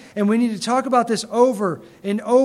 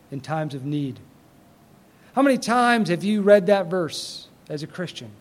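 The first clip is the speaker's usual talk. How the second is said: normal talking voice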